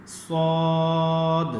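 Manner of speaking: سواد slowed, drawn out full and heavy; heavy swad. Shown as a demonstration. Teacher demonstrating the heavy letter again. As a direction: make سواد thick and slow. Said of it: "موٹا کر کے پڑھا جاتا ہے".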